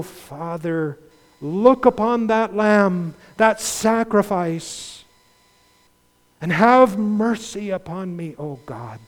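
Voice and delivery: fervent prayer. Acted. Preacher voicing the tax collector begging God for mercy. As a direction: pray it out, pleading over the lamb.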